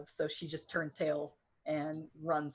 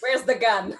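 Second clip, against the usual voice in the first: much louder, raised pitch; angry demand; 6 semitones higher, 10 dB louder than usual. Acted. Player's character demands the gun from him, angry shout. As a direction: fire the question at full volume.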